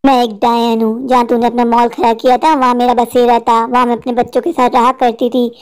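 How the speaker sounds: very low, menacing witch voice; low monotone demon-woman; vengeful witch. Acted. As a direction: deliver the threat as a deep-voiced witch. Witch reveals herself, threatens him.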